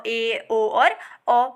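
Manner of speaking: ए, ओ and औ drawn out very slowly, one by one; slow vowel demo. Shown as a demonstration. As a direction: stretch ए, ओ, औ with a half-open mouth. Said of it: "आधा खुलता है".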